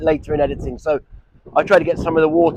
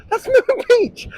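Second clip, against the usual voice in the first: laughing mid-word. Real, not acted. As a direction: break into a laugh on it. Repeats it laughing at himself.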